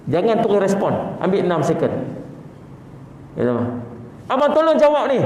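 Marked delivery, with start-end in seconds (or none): 4.3-5.2 wife calling. Acted. Wife's demanding call.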